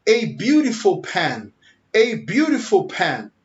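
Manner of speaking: slow, stressed "a beautiful pen"; slow emphatic demo. Shown as a demonstration. Teacher modelling emphatic a, slowly. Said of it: "vamos falar melhor".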